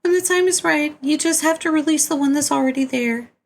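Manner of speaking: very high put-on voice giving instructions; high-pitched otherworldly character. Character briefing players on the frog's journey, high put-on voice. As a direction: pitch it far up, sustained and steady.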